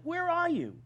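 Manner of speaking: very high where are you, stress on are; high pleading cry. Retold or imitated. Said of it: "they basically say to God".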